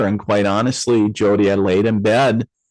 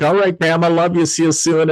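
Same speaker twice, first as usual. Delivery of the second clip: higher-pitched little boy voice, put on; imitating a little boy, pitched up; six-year-old boy; 8 semitones higher than usual. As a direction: play a small kid hurrying out a goodbye. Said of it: "like the six-year-old little boy in me".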